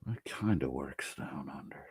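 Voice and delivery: whispered aside. Real, not acted. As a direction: breathe it out as a secret. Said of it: "unvoiced whisper".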